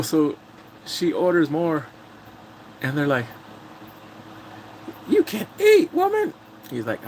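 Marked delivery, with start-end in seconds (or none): 5.1-6.3 high-pitched, hammed-up protest; exaggerated imitation of an indignant diner; mock scolding. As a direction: squawk it like an exasperated heckler. Acts out diners scolding a woman.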